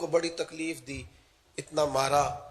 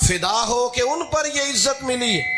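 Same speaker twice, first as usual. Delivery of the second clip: fervent preaching; 8 semitones higher, 3 dB louder than usual. Real, not acted. Preacher glorying in praise of the Prophet, high fervent proclamation. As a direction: proclaim the verse with climbing fervour.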